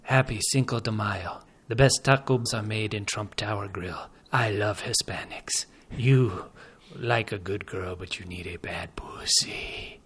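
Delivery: put-on low, hushed Dornish accent, sinking to a whisper. Host reading a special script for the contest, hushed Dornish accent. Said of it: "I get to do the Dornish accent".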